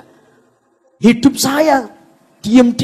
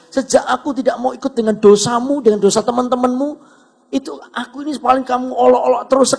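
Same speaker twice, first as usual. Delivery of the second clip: big inner question; 6 semitones higher than usual. Retold or imitated. Yud silently questions the friend who always mocked, insistent and puzzled. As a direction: press each phrase in puzzled challenge. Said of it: "muncul sebuah pertanyaan besar".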